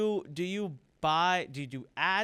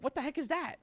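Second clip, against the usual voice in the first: baffled; 10 semitones higher, faster than usual. Retold or imitated. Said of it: "I'm like".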